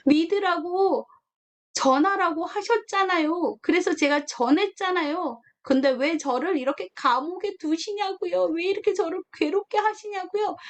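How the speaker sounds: jailed believer complaining to God, whining; whiny complaint to God. Pretend. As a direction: whine at God like a wronged child. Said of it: "불평하지 않을까요?".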